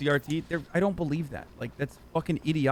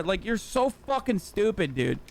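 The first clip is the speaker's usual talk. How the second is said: ordinary talk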